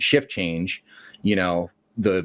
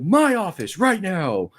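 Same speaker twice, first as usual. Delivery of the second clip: higher, slow, stern command; stern order; 10 semitones higher, slower than usual. Retold or imitated. Manager ordering the worker into his office, slow stern command. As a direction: spell out each word as an order.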